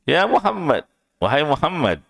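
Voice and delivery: "Ya Muhammad" yelled high, falling away; loud calling. Retold or imitated. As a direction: call out his name impatiently. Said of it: "teriak dengan suara yang keras".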